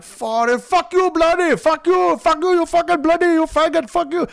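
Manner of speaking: put-on very high, loud accented tirade; screeching mock Indian-accented rage; mad Indian scammer. Scammer finding out his time is wasted, mad outburst. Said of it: "they get all mad".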